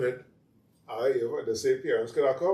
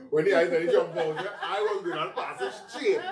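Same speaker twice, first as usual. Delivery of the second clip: laughing; 6 semitones higher, 4 dB louder than usual. Real, not acted. Telling joke, laughing.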